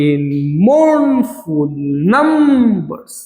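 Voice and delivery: drawn-out syllables for counting; syllable-by-syllable scansion. Shown as a demonstration. Teacher splitting a line of verse into syllables, slow and drawn out. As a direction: stretch every syllable slowly, like scanning verse. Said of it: "তার মানে দুটো syllable, একটা slash".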